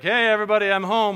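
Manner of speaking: cheerful dad. Retold or imitated. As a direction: greet the family without a care. Dad arrives home cheerfully.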